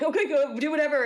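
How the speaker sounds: a breezy go ahead, whatever; high resigned dismissal. Retold or imitated. Retold letting them go.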